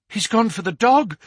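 high-pitched cry of alarm. A narrator's reading. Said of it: "cried Miss Hunter".

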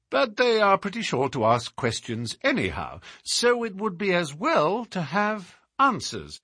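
self-assured Holmes-like dialogue; probing detective. Acted. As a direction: lay out a pointed question like a detective. Holmes voiced, questioning the inspector.

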